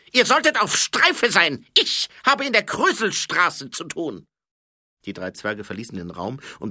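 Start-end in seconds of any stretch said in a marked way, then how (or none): 0.1-4.3 high, loud barked order; calling out. Acted. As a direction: shout the dwarfs to attention. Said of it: "rief Feldwebel".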